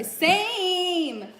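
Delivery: long, high, loud cry of agreement; excited shout. Real, not acted. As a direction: stretch SAME into a high holler. Excitedly agreeing about favorite flavor.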